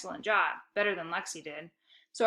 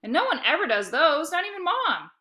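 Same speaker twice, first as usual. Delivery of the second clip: higher, louder boast; calling out proudly; 7 semitones higher, 5 dB louder than usual. A narrator's reading. Boy bragging to dad from hallway. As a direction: shout the brag across rooms.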